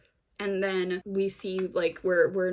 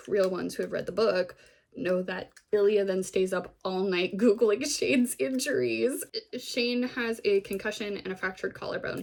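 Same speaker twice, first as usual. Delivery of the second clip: ordinary talk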